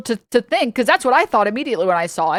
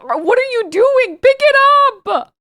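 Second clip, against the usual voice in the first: much higher, louder shrieking mom; a furious mother shrieking at her child over spilled milk; angry mom yelling; 8 dB louder than usual. Retold or imitated. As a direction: scold the kid who spilled the milk, yelling. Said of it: "my mom would... be like".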